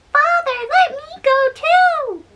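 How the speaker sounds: put-on little boy voice. A narrator's reading. Thumbling begs his father to take him ploughing, high childlike. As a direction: plead up high like a tiny boy.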